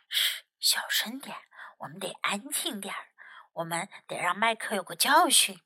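whispered, then low and soft; hushing. A narrator's reading. Tim hushing his friend by the trap, whispery quiet.

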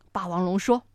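plain reading voice

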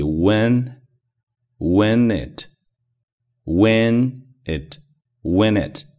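when it linked into one word, wheni-t, said slowly; linking sounds. Shown as a demonstration. Teacher showing how when it links, slow linked drill. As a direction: glide when into it with no break. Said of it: "连在一起".

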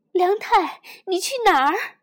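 loud high call; a mother shouting after her child. Acted. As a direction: call after him anxiously. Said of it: "妈妈叫着".

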